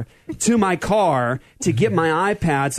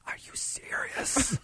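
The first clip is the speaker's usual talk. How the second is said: very slow stage whisper; mock-horrified whispered question, drawn out; ridiculous disbelief; 8 semitones higher, 14 dB quieter, much slower than usual. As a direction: whisper it as if utterly stunned. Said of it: "And I was ridiculous".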